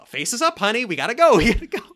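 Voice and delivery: high, loud forced-cheerful voice through laughter; fake cheer. Husband urging wife with forced cheer, hammed-up fake happiness. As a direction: fake a big smile and push her along. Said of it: "big fake smile".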